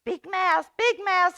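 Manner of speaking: high, loud call for help; high loud urgent calling. A narrator's reading. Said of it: "he called".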